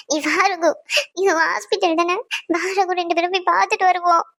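tearful, sniffling plea; weepy, pleading voice; crying. Acted. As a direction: beg through tears to be let in. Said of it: "ஏன் இப்படி அழுதுகிட்டு இருக்க".

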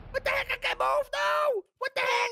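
high, frantic cries of alarm; play-acted trapped panic; panicked yelling. Trapped victim, unable to move.

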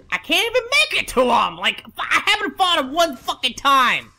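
real worked-up gamer rant; exasperated rant. Real, not acted. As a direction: complain loudly, pitch jumping up in outrage. Ranting about failed attempts.

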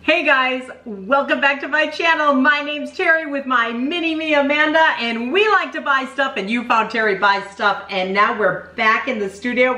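upbeat host welcome; bright channel opener energy; YouTube intro voice. Acted.

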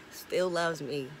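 normal talking voice

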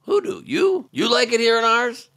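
can you believe this, rising in disbelief; incredulous. Acted. Comedian repeats Green's line in shocked disbelief.

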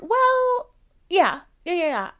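exaggerated obviously-yes; sassy agreement.

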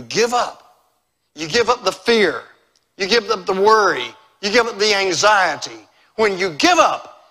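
loud, rhythmic pulpit build; forceful preaching. Real, not acted. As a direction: hammer each repeated phrase home to the congregation.

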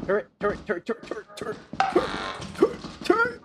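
urgent warning. Real, not acted. Warning of turret.

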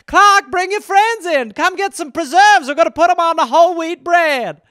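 put-on old-time homemaker. Calling Clark and friends in for preserves, old-time homemaker. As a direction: call the kids in with hammed-up cheer. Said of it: "a different era when someone was like".

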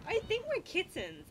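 delighted high voice; excited. Real, not acted. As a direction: squeal the discovery. Delighted discovery.

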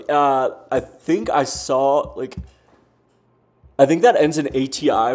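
ordinary talk

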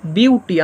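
Beauty broken in two, BIU, then TI; two-beat demonstration of beauty, BIU-TI; split into syllables. Shown as a demonstration. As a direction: say beauty as two separate beats. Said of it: "beauty একবারে বলতে পারছে না".

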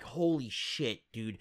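low, slow, awed murmur; stunned hush. Retold or imitated. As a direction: breathe it out in disbelief. Stunned reaction to Sam's line.

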